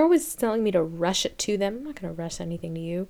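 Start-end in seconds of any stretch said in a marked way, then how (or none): 1.8-3.1 whisper. Real, not acted. Whispered aside.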